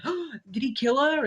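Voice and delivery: high, shocked question; shocked gasp-question. Retold or imitated. Heroes seeing their teammate struck down, shocked. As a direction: blurt it out in disbelief. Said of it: "they're like".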